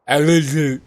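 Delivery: odd delivery. Real, not acted. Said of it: "Don't know why I said it like that".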